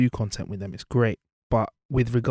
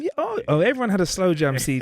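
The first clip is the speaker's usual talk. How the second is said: excited; 8 semitones higher than usual. Real, not acted. Excited memory of slow jam CDs.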